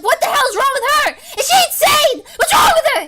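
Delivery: high, loud laughing outcry; loud mock disbelief through laughter; shocked viewer. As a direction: shriek it as a baffled onlooker. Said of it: "be like".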